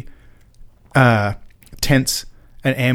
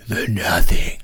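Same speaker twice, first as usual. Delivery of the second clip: breathy, low whispered film quote; creepy movie whisper; 7 semitones lower, much slower than usual. Retold or imitated. Host whispering the film's famous line, eerie whisper.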